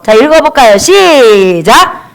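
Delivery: long, stretched call to begin; drawn-out cue. Real, not acted. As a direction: cue a congregation to read in unison. Cues congregation to read together.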